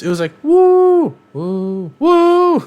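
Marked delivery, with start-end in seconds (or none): 0.4-1.1 loud, high crowd "woo"; imitated big cheer. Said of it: "it went Channing Tatum". 1.3-1.9 a half-hearted crowd whoop; imitated weak cheer. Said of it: "Jennifer Garner". 2.0-2.6 loud, high crowd "woo"; imitated big cheer. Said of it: "and then Wesley Snipes".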